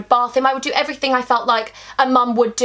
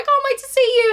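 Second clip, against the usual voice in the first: much higher, eager and sweet; 13 semitones higher, faster than usual. Retold or imitated. Girlfriend excited for date night, high and eager. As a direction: gush it at the boyfriend. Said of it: "I was like".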